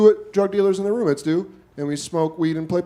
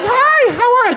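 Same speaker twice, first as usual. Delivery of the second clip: very high, overly polite clerk chirp; customer service voice; 4 dB louder than usual. Retold or imitated. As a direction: greet like an over-polite customer service rep. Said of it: "I get, like, customer service voice".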